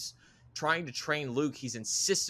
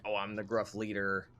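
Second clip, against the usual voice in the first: mock gruff leader; 3 semitones lower than usual. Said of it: "that archetype of".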